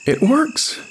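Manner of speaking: put-on very high child's exclamation; amazed cry. A narrator's reading. Boy amazed that bear gives kisses, high excited cry. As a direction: cry out in a little boy's high voice. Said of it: "he cried".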